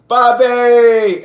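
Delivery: one long, high, loud holler; calling out. Retold or imitated. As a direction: hail a star across the way, stretching the name. Re-enacts hailing a celebrity.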